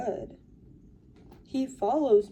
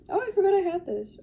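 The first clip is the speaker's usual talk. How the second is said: surprised find; 10 semitones higher, faster than usual. Real, not acted. Finds item in purse.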